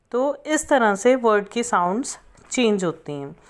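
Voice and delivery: ordinary delivery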